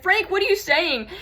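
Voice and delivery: high, loud call to Frank; retold shout. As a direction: shout the question as in the memory. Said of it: "so I shouted".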